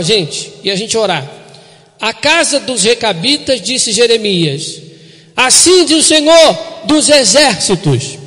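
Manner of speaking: loud, weighty proclamation; preaching at full force. Acted. Pastor closes with the verse read loudly to the church. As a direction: read the verse like a thunderous promise.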